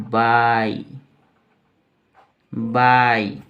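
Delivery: बाई drawn out with a long "aai"; pronunciation demo. Shown as a demonstration. Teacher showing how to write and say it, stretched बाई. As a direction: hold the vowel of बाई, each time as a separate word. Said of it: "इसको बोलेंगे".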